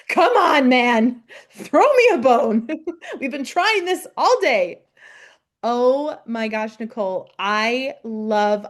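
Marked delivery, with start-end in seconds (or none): 0.1-4.8 louder, exasperated begging; pleading mock complaint. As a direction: beg for a break, half joking. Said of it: "Just like".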